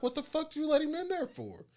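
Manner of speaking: high, loud, incredulous yell; mock an irate coworker demanding answers; angry coworker. Coworker shouting at the new guard for letting him in. Coworker yelling in retold scene.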